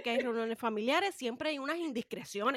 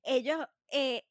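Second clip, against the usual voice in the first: ordinary delivery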